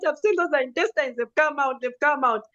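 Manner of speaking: a hint of tears in the voice; retold tearful telling. Telling her aunt about the scare, mildly tearful. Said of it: "Now I'm crying".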